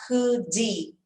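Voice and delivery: "could he" run together as "coudi", the H dropped; linked could he. Shown as a demonstration. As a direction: join "could he" into one word, no H. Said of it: "Eu junto esse som aqui do could com esse E".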